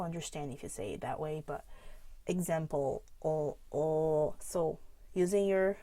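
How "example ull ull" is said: example with a dark L, then the L held alone; example, then "ull, ull". Shown as a demonstration. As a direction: pull the tongue back on the end of example. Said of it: "practicing the dark L".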